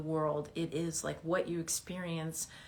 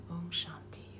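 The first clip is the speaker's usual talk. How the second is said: quiet om; 9 dB quieter than usual. Real, not acted. Closing meditation chant.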